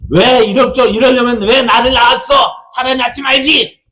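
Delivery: high, loud, bitter yell at a parent; resentful child's shout. Retold or imitated. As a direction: scream it like a furious teenager.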